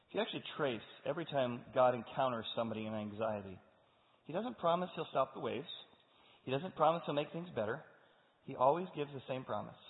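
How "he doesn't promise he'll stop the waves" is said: ordinary delivery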